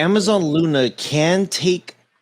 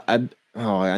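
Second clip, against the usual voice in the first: normal talking voice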